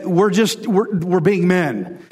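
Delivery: ordinary talk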